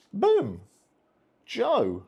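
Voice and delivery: high, loud celebratory call; excited. Real, not acted. Celebrates character gaining experience.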